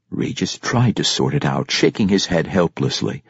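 usual speaking voice

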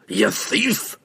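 furious dwarf shout, high and sharp; angry roar. A narrator's reading. Dwarf roaring at the halfling, angry shout. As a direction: roar the accusation at the thief. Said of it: "Bruner roared".

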